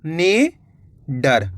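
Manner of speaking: "निडर" slowly in two parts, नि and डर; split into parts. Shown as a demonstration. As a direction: break "निडर" with a long pause after नि. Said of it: "तो मिलके बन गया".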